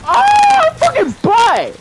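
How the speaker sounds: high, loud real scream of frustration; frustrated scream. Real, not acted. Gamer screaming at his own mistake, very loud and high. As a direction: blow up at a bad play.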